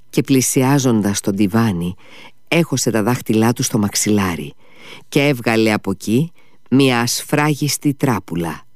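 ordinary delivery, nothing marked

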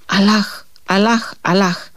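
slow drawn-out cries of Αλάχ; crying out in terror. A narrator's reading. Tsetis fleeing the dying captain, crying Αλάχ in terror. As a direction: call on God as you flee in panic. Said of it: "το έβαλε στα πόδια φωνάζοντας".

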